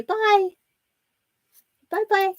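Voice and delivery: put-on high squeaky baby-talk bye.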